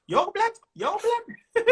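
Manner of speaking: high, laughing Birmingham accent impression. Comic mimicking a Birmingham crowd member in a laughing Birmingham accent. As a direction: ham up a Brummie fan through laughter. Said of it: "I hear the accent".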